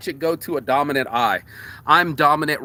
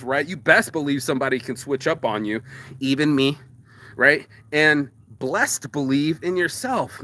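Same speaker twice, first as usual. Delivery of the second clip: ordinary talk, nothing marked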